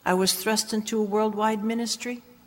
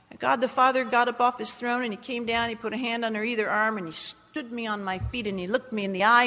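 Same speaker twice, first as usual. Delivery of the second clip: usual speaking voice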